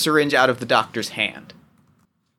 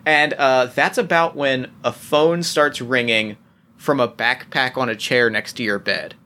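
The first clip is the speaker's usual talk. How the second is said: usual speaking voice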